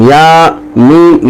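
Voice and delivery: یا and میم spoken slowly as sounds of جیم; spelled out slowly. Shown as a demonstration. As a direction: break the name into slow parts. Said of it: "جیم تو بظاہر ایک حرف ہے".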